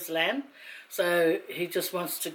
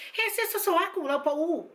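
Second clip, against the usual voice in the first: imitating a girl; 9 semitones higher than usual. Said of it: "one of the girls was going".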